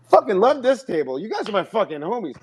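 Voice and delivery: high, excited crowd-work voice; hyped comedian. Retold or imitated. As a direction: play the comedian hyping up the table.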